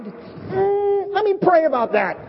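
high, hesitant "Mm" before let me pray about that; hammed-up fake spirituality; mock pious stall. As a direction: stall sweetly to get out of it. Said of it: "is like a fake thing".

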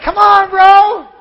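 high, loud, drawn-out urging; urging friend. Retold or imitated. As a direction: egg your buddy on. Elias urging him across.

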